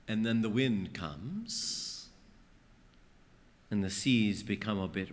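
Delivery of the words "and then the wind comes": ordinary talk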